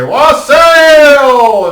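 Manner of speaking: high, loud answering roar; the whole hall shouts back; crowd toast. Acted.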